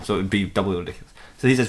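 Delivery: normal talking voice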